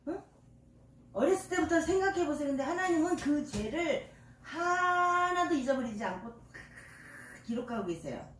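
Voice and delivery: ordinary talk, nothing marked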